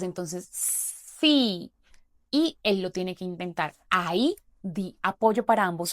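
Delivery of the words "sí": sí drawn out slowly, the s gliding into the i; slow blended syllable. Shown as a demonstration. Therapist modeling the joined syllable for a child, very slow. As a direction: stretch sí out so the two sounds join. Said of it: "Y vamos a juntarlas".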